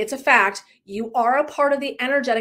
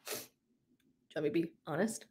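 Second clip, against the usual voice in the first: hushed reply; 7 semitones lower, 13 dB quieter than usual. Retold or imitated. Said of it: "And I go".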